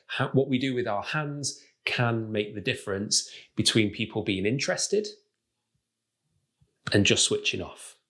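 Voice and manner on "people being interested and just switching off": long pause before "and just switching off"; pause for emphasis. Shown as a demonstration. Coach shows how pausing draws people in, long pause after "interested". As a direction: lift on "interested", hold the silence, then drop on "and just switching off". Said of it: "notice what we did there".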